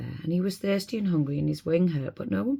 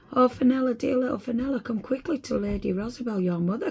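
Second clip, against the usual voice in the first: much higher eager call; excited fairies; 8 semitones higher than usual. Acted. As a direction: fairies calling her joyfully, much higher. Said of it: "cried all the fairies".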